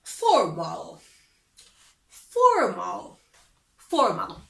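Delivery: low, dramatic repeated title; announcer. Shown as a demonstration. Teacher announcing the formal section, mock dramatic call. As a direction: call out the word like a show title. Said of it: "teacher announces section".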